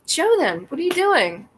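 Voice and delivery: loud, punchy exasperation; incredulous retort. Retold or imitated. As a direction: snap the demand out in disbelief.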